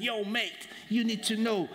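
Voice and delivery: high-pitched, hammed-up Cockney; Cockney accent. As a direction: call to your mate like a South London Cockney. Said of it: "with the Cockney accent".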